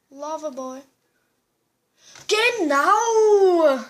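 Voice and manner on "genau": excited shout. Real, not acted. Cheering in game.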